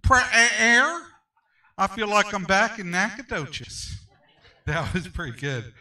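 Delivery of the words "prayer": loud, drawn-out East Texas twang on Prayer; hammed-up Texas drawl, rising; East Texas accent. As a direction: mimic the East Texas friend's answer. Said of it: "My East Texas friend?".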